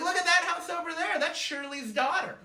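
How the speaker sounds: imitated mom's excited chatter; chirpy mom impression. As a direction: point it out eagerly as mom. Mom pointing things out.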